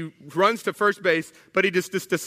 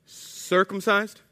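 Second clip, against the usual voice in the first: slow, drawn-out incredulous echo; acted disbelief, each syllable stretched; aghast question; much slower than usual. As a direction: repeat it slowly as a stunned question. Convert repeats the word aghast.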